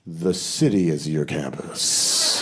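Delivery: low, slow, mock-profound pronouncement; pompous tour guide. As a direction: say it like deep wisdom, slowly.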